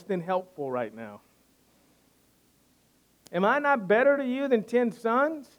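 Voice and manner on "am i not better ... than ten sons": mock husband. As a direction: send up the husband's clueless question. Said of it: "Imagine the look that Hannah gives Elkanah".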